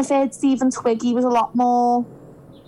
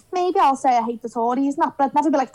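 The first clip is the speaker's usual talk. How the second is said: usual speaking voice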